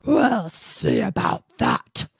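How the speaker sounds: slow, loud growled threat; growling bear. Acted. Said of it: "growled the bear".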